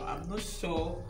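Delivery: normal talking voice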